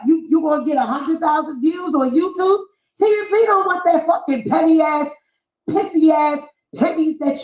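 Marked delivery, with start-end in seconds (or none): none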